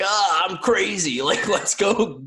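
hammed-up wild-kid excitement; crazy wild girl. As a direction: playfully act the rowdy little girl. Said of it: "like the girls that are like".

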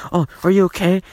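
high mock-worried question; mock concern. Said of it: "a person says something".

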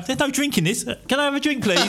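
put-on high, silly drunk asking for a drink; a hint of a tipsy pleading voice; mock drunk pleading. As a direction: play your drunk self begging the barman.

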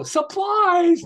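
high loud shout of "Supplies!"; bellowed office greeting. Retold or imitated. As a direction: yell it as a running gag. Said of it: "And they go".

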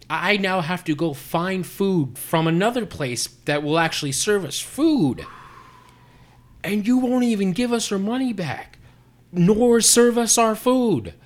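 high, loud, furious, slowing at the end; heated rant. Retold or imitated. Ranting at the manager over the wasted hour, furious shouting. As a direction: hammer each complaint at him, livid. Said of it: "I was so heated".

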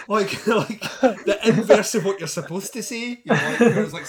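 talking through laughter, high; laughing. Real, not acted. Telling the co-host through laughter, high and loud. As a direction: giggle the words out.

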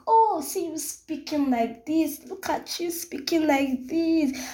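high, slow, thrilled at her own voice; delighted self-talk. Retold or imitated. Talking to herself in the mirror, high and slow with joy. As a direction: gush at the mirror, high and drawn out. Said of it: "I'm like, looking in mirror".

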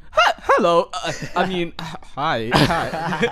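nervous high voice cracking up. Retold or imitated. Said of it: "His voice just cracked".

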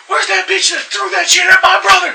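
high, loud mock yell; angry sister storming in looking for the culprit, high shouted. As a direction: storm in raging.

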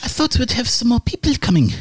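put-on high, fast, croaky old woman; croaky old lady. GM retries the lady's line, high and croaky. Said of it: "just do a croaky voice".

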